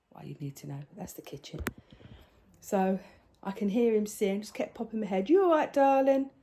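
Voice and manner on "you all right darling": raised, higher call round the corner; calling out to grandson. Retold or imitated. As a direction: call out sweetly to check on him.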